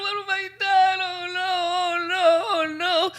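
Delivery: high, loud anguished cry; horrified wail. Acted. Marjorie crying out after knocking off his head, horrified wail. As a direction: wail in horror at what she did.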